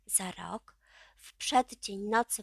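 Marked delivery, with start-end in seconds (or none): none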